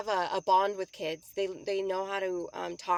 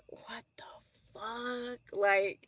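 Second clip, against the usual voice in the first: whispered, slow disbelief; stunned, hushed and slow; shocked whisper; 2 semitones higher, much slower than usual. Retold or imitated. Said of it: "they're just like".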